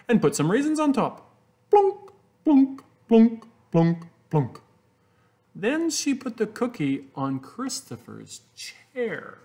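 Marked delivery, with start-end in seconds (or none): 1.7-4.6 high, loud plunks dropping down. A narrator's reading. Reading to kids, raisin dropping. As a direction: drop each plunk like a raisin.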